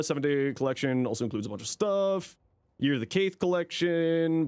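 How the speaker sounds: normal talking voice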